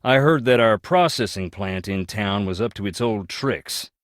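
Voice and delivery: ordinary talk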